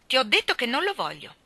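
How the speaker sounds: put-on stubborn child's refusal; insistent repeat. A narrator's reading. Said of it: "ripeté Mary".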